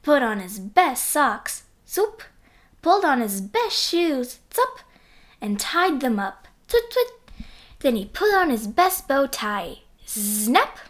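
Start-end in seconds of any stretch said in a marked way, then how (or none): 1.9-2.2 high zoop sound effect; a quick high zoop. A narrator's reading. 4.5-4.8 very high zup sound effect. A narrator's reading. 6.7-7.5 high chirpy twit twit; 1 twit sounds. A narrator's reading. Sound effect of tying laces.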